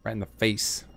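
normal talking voice

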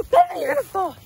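high loud alarmed cry; alarmed warning. Real, not acted. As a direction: warn her before she falls. Warning girl about to fall.

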